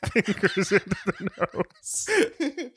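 high, slow words squeezed through laughter; helpless laughter. Real, not acted. Podcaster describing the crumpled shirt image, helpless high laughing. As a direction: crack up while trying to finish the sentence.

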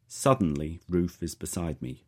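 ordinary delivery, nothing marked

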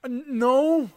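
high loud flustered no; defensive denial. Retold or imitated. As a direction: deny it in a panic. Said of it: "So they're like".

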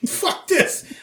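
high-pitched bail-out exclamation; mock panic. Teller imagining losing his ear, mock-panicked. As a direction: blurt it high, ready to flee.

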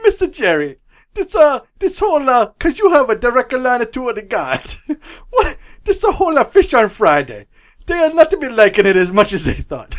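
hammed-up Italian Pope pleading on the phone; mock Italian accent breaking into laughter; bad Italian accent. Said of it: "stereotypical bad Italian accent".

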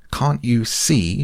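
high slow indignant shout. A narrator's reading. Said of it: "shouted Piglet".

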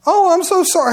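high, loud, hammed-up condolence; mock sympathy. People brushing off a bereaved stranger, exaggerated fake sympathy. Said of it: "most people are going".

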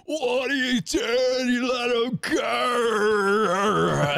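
very high, slow, crying shout in a fake Australian accent; bad Australian accent. Mocking the guy at the end of the film, wailing bad Australian accent. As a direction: wail it in an over-the-top bad Australian accent. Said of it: "a real Australian in here".

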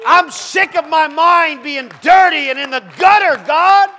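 a preacher's soaring, shouted challenge to the church; preaching at full force. Acted. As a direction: throw it at the congregation, high and loud. Preacher cries out to God.

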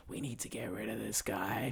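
low, hushed stage whisper; conspiratorial whisper. Retold or imitated. Confiding in main housemate about the other one, whispered. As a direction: whisper it like a secret plan.

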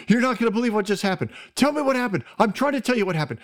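high, fast breathless blurting; excited rush. Retold or imitated. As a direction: tumble the news out in a rush. Breathless sister bursting with news.

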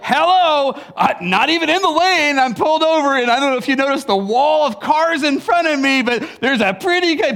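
exasperated mock rant. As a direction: tell the oblivious guy off, raising the voice. Mock rant at clueless driver.

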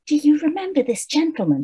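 high, quick, sugary witch voice; sugared-over witch. Acted. Witch turning to ask her toads, sugared-over. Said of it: "very sugared over".

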